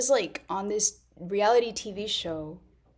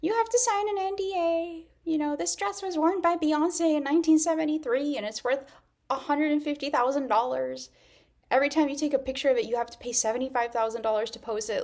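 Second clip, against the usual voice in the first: mock snooty stylist; 7 semitones higher than usual. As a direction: lay down impossible rules like a precious stylist. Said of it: "a lot of stylists are kinda like".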